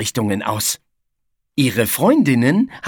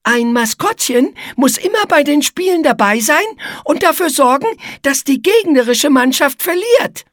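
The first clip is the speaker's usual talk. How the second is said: very high, squeaky explaining voice; high-pitched, eager character voice; Igitte, persuasive spider; 12 semitones higher, 4 dB louder than usual. Acted. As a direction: sell the job in a little spider's squeak.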